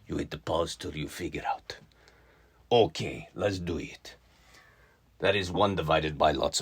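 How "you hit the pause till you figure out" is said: soft whispered instruction. Real, not acted. Teacher whispering to pause the video.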